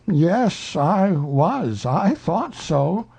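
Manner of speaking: a dawning, puzzled bear; Pooh. A narrator's reading. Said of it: "said Pooh".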